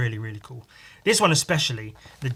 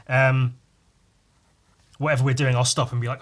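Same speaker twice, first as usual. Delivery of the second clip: normal talking voice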